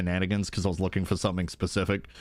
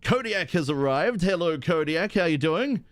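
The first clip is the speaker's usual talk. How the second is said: ordinary talk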